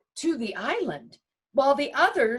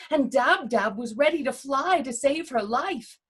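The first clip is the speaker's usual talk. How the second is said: ordinary delivery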